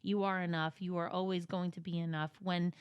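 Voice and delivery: soft, low-key letter reading; reading aloud. Acted. Reading a friend's Mother's Day note, soft reading.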